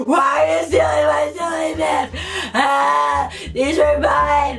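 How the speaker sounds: whine-shout it, slow and outraged; tantrum yell. Retold or imitated. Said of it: "I'm out here having a tantrum".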